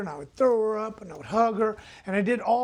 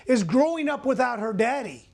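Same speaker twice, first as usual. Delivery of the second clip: usual speaking voice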